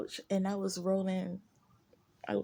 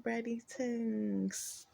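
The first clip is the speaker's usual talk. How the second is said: drawn-out sign-off; 3 semitones higher, 4 dB quieter, much slower than usual. Real, not acted. Sign-off name for fans.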